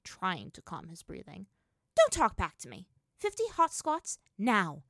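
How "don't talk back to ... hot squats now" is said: loud, high-pitched order; commanding. A narrator's reading. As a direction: order the punishment with force. Strict sister orders punishment drill.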